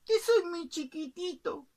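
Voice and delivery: high, slow singsong plea; pleading little bird. Acted. Bird begs the cook to kill gently, high slow plea. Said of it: "el pajarito dijo".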